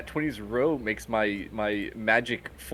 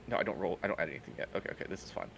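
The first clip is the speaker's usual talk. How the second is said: soft, under-breath self talk; muttering to self; 7 semitones lower, 11 dB quieter, much faster than usual. Real, not acted. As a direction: mumble it to yourself. Muttering to self over dice.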